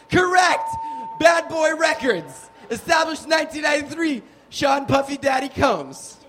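high, emphatic game-show shout; shouted quizmaster confirmation. Acted. Comic confirming audience's record label answer, high shout. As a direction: call it out like a quizmaster.

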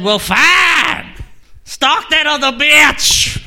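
high, sharp shout then slow; mock angry girlfriend. Ex-girlfriend dumping the stalker, high and angry. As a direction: snap it like a jilted ex. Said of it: "She said".

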